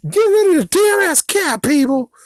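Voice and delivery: high, loud mocking shriek; mocking the neighbors. Mocking the angry neighbors in a high shriek. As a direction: squeal the demand like an angry mob. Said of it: "mocking the neighborhood's demand".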